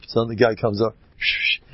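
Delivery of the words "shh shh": voiceless shushing whisper noise; imitated whispering. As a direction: mimic someone whispering in an ear.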